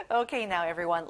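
normal talking voice

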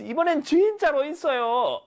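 high, insistent calling voice; acted shouting. Shepherd boy insisting to villagers, mock shouting. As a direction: plead it at a high, carrying pitch. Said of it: "이렇게 막 소리를 질렀겠죠".

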